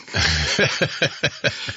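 airy laughing burst; breathy laugh. Real, not acted. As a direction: let a laugh escape. Both crack up.

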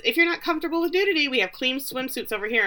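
high, fast chirpy offer; fast sales patter. Retold or imitated. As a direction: rattle it off brightly. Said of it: "and she says".

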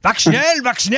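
high shouted mock German commands. Imitating Die Hard villains to cohost, high mock German. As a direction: bark it like a movie villain. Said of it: "what the hell they're saying in Die Hard".

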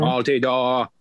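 put-on old New England accent. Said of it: "saying Altidore in a New England accent".

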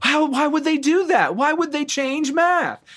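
very high, straining mock disbelief; exasperated dad. Dad told that math has changed, high-pitched mock outrage. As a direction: wail it like a baffled, outraged father. Acted dad baffled by new maths.

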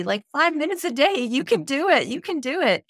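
pep-talk push: you can do it; playful coaxing. Real, not acted. Speaker urging women to find five minutes, cheering them on. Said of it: "I'll guilt you into it".

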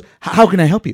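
high, loud sneering clerk; mocking clerk voice. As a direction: ham up the useless clerk. Repeats clerk line, louder.

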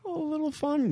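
high, soft playful tone; silly high voice. Acted. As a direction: chirp it in a high squeak.

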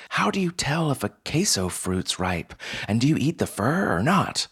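high-pitched, puzzled new worker; questions pitched high and swooping upward; bewildered newcomer. Acted. Newcomer boggling at unknown foods in the aisles, bewildered.

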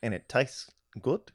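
soft, halting mock New Zealand accent; hesitant, quiet New Zealand accent; Peter Jackson. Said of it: "I immediately hear Peter Jackson telling me about how to prepare".

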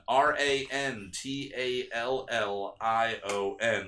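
normal talking voice

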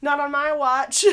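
high, loud playful sass; sassy instructor. Retold or imitated. Instructor tells a struggling student she won't give up, sassy. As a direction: refuse to let her quit, cheeky and firm. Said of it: "the instructor going".

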